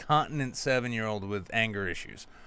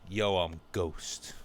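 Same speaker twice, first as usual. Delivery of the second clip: low, slow would-be gangster intro; mock tough guy; 6 semitones lower, 4 dB quieter, slower than usual. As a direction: drop low and slow to play the poser. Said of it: "Introduces himself as".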